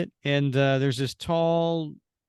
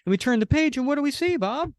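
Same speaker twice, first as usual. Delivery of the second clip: high, excited lead-in to a reveal; pitched-up storyteller's tease to a co-host; suspenseful build-up; 12 semitones higher, faster than usual. Real, not acted. Building up the page-turn reveal.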